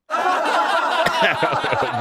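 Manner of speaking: high laugh. Real, not acted. Streamer cracks up at own voice.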